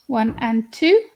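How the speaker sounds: ordinary talk, nothing marked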